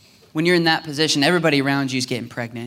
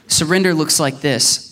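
ordinary talk, nothing marked

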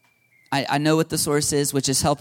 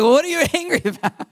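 high-pitched question through laughter; amused mock questioning; 11 semitones higher, faster than usual. Preacher acting out probing someone's anger, laughing question. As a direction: laugh while playing the puzzled questioner.

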